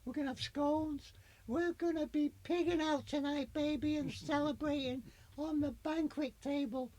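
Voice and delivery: high posh English queen voice; posh English accent. Pretend. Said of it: "If I talk like this".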